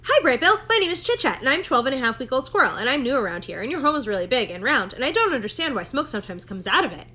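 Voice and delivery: fast, high, breathless squirrel chatter; rambling chatterbox squirrel. Acted. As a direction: gush it all out without stopping for air. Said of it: "Chit Chat rambled on and on".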